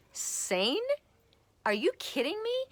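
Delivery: high, loud retold disbelief; incredulous. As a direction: act out a stunned, squeaky-high comeback. Retold incredulous reaction to email.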